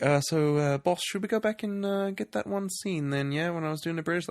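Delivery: high, hesitant mock request; timid actor. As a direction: imitate a sheepish employee asking the boss. Actor timidly asks boss, acted.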